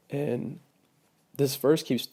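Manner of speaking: usual speaking voice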